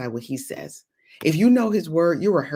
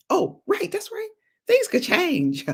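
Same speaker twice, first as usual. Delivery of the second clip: high, sing-song mock doubt; a much higher scoffing voice, teasing; mocking doubter; 14 semitones higher, slower than usual. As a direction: play the naysayer with a high mocking lilt. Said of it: "mocking doubter voice".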